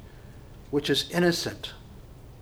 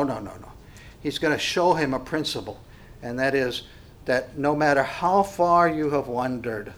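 normal talking voice

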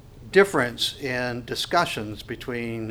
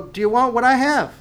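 high, bright offer; inviting question; 8 semitones higher, 5 dB louder than usual. Retold or imitated. Believer inviting the envious unbeliever, high and welcoming. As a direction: hold out the invitation gently, raised pitch.

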